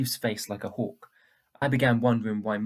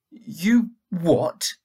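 high, loud disbelieving "You what?"; a sudden shocked cry of disbelief; shocked outburst; 6 semitones higher, 9 dB louder than usual. Acted. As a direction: blurt it out in shock.

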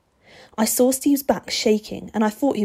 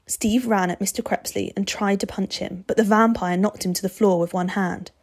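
ordinary delivery, nothing marked

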